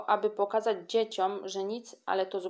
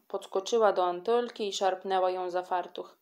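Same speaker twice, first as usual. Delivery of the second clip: ordinary delivery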